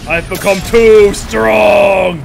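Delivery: villain gloat. Acted. Gloating in triumph.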